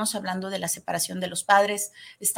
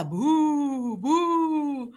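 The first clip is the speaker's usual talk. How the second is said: a light pretend ghost "bú, bú"; half-hearted spooky boo; lightly voiced sad ghost; mock ghost boo; 5 semitones higher than usual. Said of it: "Papá grita".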